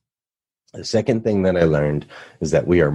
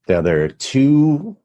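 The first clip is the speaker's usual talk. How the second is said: ordinary delivery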